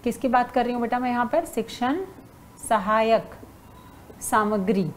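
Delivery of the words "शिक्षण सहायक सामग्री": slow, with a pause after each word; word-by-word dictation. Real, not acted. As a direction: dictate it one word at a time for note-takers. Dictating the term while writing it. (एक-एक शब्द रुक-रुक कर लिखवाते हुए)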